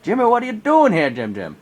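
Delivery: high, loud calling out a name; loud playful hail; yelling across the lake. Retold or imitated. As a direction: shout to a friend far off. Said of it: "I yelled across to him".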